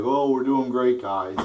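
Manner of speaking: mock upbeat politician; mock cheerful brag; mocking Jeb. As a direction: sing it out like a smug loser pretending he's winning. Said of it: "and he's like".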